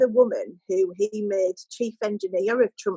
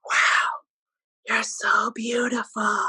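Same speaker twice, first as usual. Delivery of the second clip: imitating him, slow whispered gush; awestruck mock whisper; much slower than usual. Interviewer retells his flattering her on arrival, slow mock whisper. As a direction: stretch it out, hushed and wowed, as mimicry. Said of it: "and just going".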